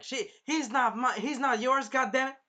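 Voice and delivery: hammed-up jealous protest; jealous retort. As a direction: fire back at a rival. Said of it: "And Raphtalia is like".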